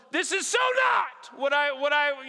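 high, slow, loud complaint; exasperated outburst. Retold or imitated. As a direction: let it burst out in disbelief.

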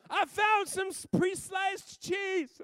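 put-on very high, slow child's voice; proud little girl reporting back; little daughter.

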